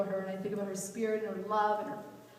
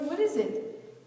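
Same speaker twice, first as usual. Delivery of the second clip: higher, insistent what is it; pressing question; 7 semitones higher, 4 dB louder, slower than usual. Retold or imitated. Pressing her mom in the car, insistent. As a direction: push the mom for the answer. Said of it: "I go".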